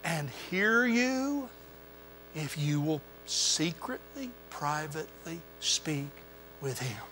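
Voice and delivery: gentle fading reassurance; soft tender promise. Acted. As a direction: draw them in with a near-hush. Voicing Jesus' gentle promise.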